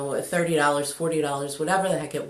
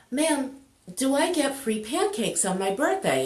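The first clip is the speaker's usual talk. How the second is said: lightly voiced little boy, pitched higher; a polite child's higher-pitched question; 6 semitones higher than usual. Little boy asking the waitress about his birthday, lightly voiced child. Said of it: "turns out it's his birthday, and he's like".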